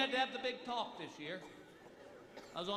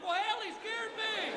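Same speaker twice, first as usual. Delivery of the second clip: indignant retort; 13 semitones higher, 3 dB louder, slower than usual. Retold or imitated. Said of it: "I'm like".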